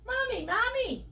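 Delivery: high-pitched call to mother; child's cry. Acted. Piglet calling to his mother across the pool, high cry. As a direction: call out high like an excited little one. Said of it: "came the cry over the gentle lapping".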